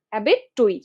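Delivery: অ্যাবেট টইট in two chunks, the "oi" in টইট sounded; spelling pronunciation. Shown as a demonstration. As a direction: say অ্যাবেট, then টইট with its i heard. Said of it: "অ্যাবেটর বললে ওই আইটা উঠে যাবে". (বানান অনুযায়ী অ্যাবেট টইট, টইটে ই স্পষ্ট)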